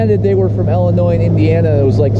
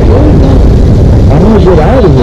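yelling; 4 semitones higher, 9 dB louder, slower than usual. Real, not acted. Boat stuck, yelling over noise.

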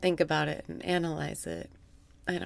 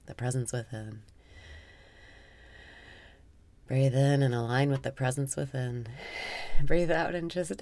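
guided meditation; 3 semitones lower, 5 dB quieter than usual. Acted. Guiding listeners through breathwork in a soft meditation voice. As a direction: guide the breath calmly, half amused.

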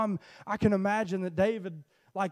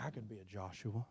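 hushed inner thought; 10 semitones lower, 18 dB quieter than usual. Acted.

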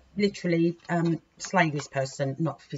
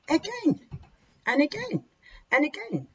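exasperated; 10 semitones higher than usual. Real, not acted. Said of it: "so annoyed for you guys".

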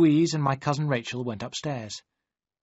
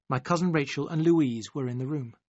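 ordinary delivery, nothing marked

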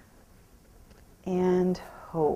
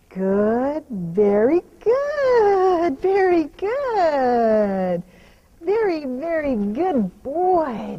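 slow, drawn-out, higher praise; 5 semitones higher, 8 dB louder, much slower than usual. Real, not acted. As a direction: stretch the words to soothe and reward.

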